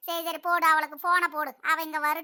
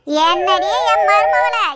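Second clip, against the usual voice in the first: drawn-out mother-in-law call; 12 dB louder, much slower than usual. Acted.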